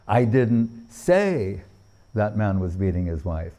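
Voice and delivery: weight on "say"; stress on SAY. Shown as a demonstration. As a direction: drag out "say", then a pause. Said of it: "According to where the emphasis is placed".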